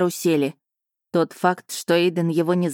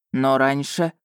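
lightly voiced man, lower, objecting; 4 semitones lower, slower than usual. Eiden objects.

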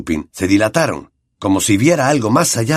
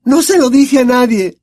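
put-on much higher and louder girl's voice, frantic; Hermione shouting; 6 dB louder than usual. A narrator's reading. Hermione yells at Lupin, much higher and louder. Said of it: "gritó Hermione".

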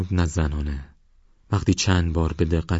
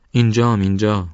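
distant answer; 4 semitones higher, 6 dB louder than usual. Acted. Answering voice from the mist.

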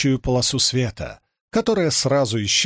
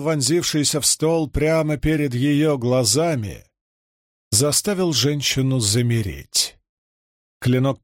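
ordinary delivery

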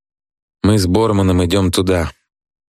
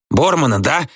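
higher, sour challenge; spiteful; 8 semitones higher than usual. A narrator's reading. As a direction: throw it back with some spite. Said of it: "кривится Градусов и злобно". (злобно, с издёвкой)

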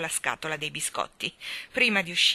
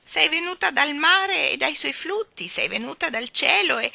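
high, loud questioning bird voice; excited bird character, urgent and loud; eager starling; 9 semitones higher, 10 dB louder than usual. Acted. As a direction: press with eager questions, pitched well up.

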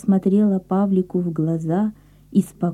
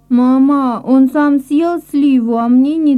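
tattle to mom in a whine; complaining child; 6 semitones higher, 6 dB louder than usual. Acted. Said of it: "пожаловался Коля".

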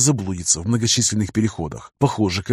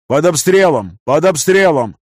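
loud, high alarmed shout; 5 semitones higher, 6 dB louder than usual. A narrator's reading. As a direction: yell the warning across the bridge. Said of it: "кричал какой-то моряк".

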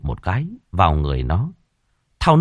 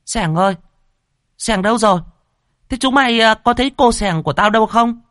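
much higher, louder, shrill scolding; snapping angrily; 4 dB louder, faster than usual. A narrator's reading. Mistress scolding servants about her lost dog, shrill. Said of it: "thì gắt um tỏi".

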